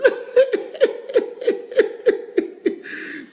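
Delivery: long airy high-pitched giggle; delighted wheezing laugh; high breathy laugh. Real, not acted. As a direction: let the comment crack you up. Laughs at viewer comment.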